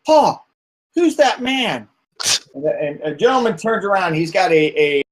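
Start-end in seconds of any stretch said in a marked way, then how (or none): 0.0-1.9 put-on higher child's voice, curious; a little boy asking his dad; small boy. GM voices a small boy asking about the stranger, high and curious. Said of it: "you hear a small boy going".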